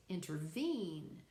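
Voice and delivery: slow "intervene", weight on VENE; stress on third syllable. Shown as a demonstration. Teacher shows an anapestic foot, "intervene" stressed on VENE. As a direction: lean into the end: in-ter-VENE. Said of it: "the third one is".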